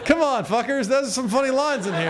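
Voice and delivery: high-pitched pleading protest; comic pleading with a tough room; mock indignant. Real, not acted. Comic scolds a quiet crowd.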